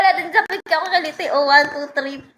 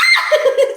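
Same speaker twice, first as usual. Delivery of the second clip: breathless tickled laugh; 9 semitones higher, 3 dB louder than usual. Real, not acted.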